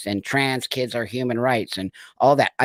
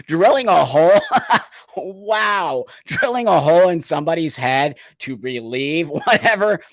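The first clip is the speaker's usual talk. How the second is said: laughing in disbelief, louder and higher; incredulous laughing; 5 semitones higher, 7 dB louder than usual. Real, not acted. Telling viewers about lobotomies, laughing in disbelief. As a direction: laugh it out, appalled and amazed.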